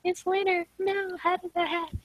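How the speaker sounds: high, soft little lament; small, high-pitched pretend shock; mock disbelief. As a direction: squeak it quietly in pretend dismay. Playful mock disbelief at winter.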